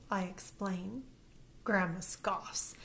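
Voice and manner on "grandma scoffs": ordinary delivery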